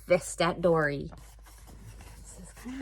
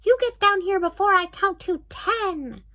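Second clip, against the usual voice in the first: high, raised witch yell; 9 semitones higher, 4 dB louder than usual. Acted. The witch yelling a countdown threat at Dory. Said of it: "the Blue Witch yelled".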